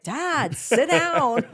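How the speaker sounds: put-on high, loud teen scold; embarrassed daughter. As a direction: snap at dad in embarrassment. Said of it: "his daughter's like".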